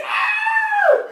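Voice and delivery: a high, loud startled shriek; screaming lady. Acted. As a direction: shriek like a woman robbed. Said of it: "and she screams".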